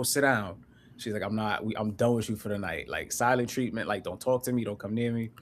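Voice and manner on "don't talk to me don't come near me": ordinary talk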